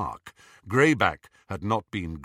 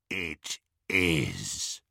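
low rasping werewolf; rasped; 6 semitones lower, 4 dB quieter than usual. A narrator's reading. Greyback to the gang, a low rasp. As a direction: rasp it with quiet satisfaction. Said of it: "rasped Greyback".

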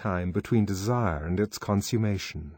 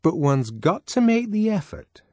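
preachy, lofty tone, pitched up; sententious; 11 semitones higher, 5 dB louder than usual. A narrator's reading. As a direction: lecture her primly. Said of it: "she said sententiously".

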